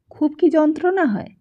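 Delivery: ordinary delivery, nothing marked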